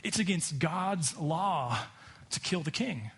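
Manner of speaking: usual speaking voice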